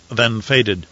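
usual speaking voice

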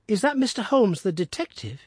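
a hint of a suspicious youth; boy's question. Son asking his father about the detective, lightly voiced.

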